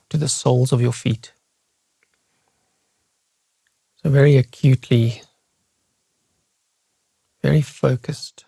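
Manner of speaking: guided meditation. Real, not acted. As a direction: lead listeners unhurriedly through their body, leaving space after each phrase. Leads a guided body scan.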